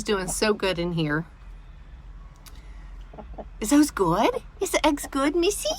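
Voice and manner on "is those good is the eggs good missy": put-on baby talk. Talking sweetly to her pet.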